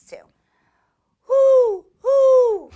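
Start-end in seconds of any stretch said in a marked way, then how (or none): 1.2-2.7 loud, high owl hoot; long hoot, high and strong. Shown as a demonstration. Host hooting for children to guess the animal, owl hoot. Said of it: "I'm imitating the way it looks".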